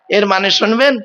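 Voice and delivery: ordinary delivery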